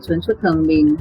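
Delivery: ordinary talk, nothing marked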